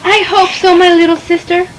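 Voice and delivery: plain reading voice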